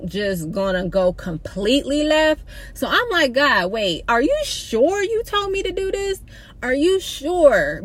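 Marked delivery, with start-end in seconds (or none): 3.3-7.8 much higher, pleading and worried; high panicky are you sure; anxious plea. Retold or imitated. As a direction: beg God for reassurance, voice climbing. Panicked question to God.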